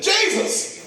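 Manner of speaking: high, sharp call with a falling end; high-pitched cry for help; hollering. Shown as a demonstration. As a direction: holler the name, not yet full out. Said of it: "hollers to the top of his lungs".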